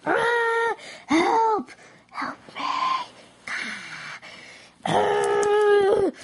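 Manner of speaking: high mock cries for help; pretend panic. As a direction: scream for help in play, pitched high. Acted victim calls for help.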